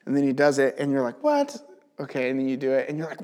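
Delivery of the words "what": sudden high squeak of disbelief; amazed what. Retold or imitated. Believer reacting to God's miracle, high amazed squeak. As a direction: pop the word up in shock.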